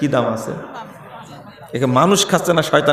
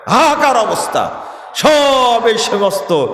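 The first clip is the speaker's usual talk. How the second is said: raised, fiery preacher's cry; preaching shout; 6 semitones higher, slower than usual. Real, not acted. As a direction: thunder it from the pulpit. Preacher shouting about the country. (চড়া গলায় ওয়াজের হুঙ্কার)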